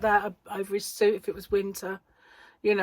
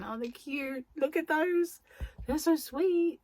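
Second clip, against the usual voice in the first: high cooing over something cute; 9 semitones higher than usual. Real, not acted. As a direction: coo like over a puppy. Cooing over tiny scissors.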